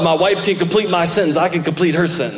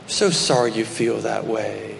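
slow, lower, softer mock sympathy; mock coddling; 7 semitones lower, 6 dB quieter, much slower than usual. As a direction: coo it like pampering a sulking friend. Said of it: "God didn't coddle Elijah".

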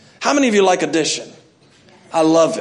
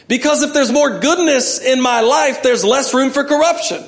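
building preaching; 4 semitones higher than usual. Acted. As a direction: preach it with rising conviction. Preaching climax.

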